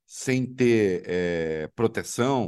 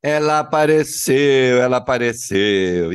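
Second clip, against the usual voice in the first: loud; 8 dB louder than usual. Real, not acted. Greeting guest arriving on air.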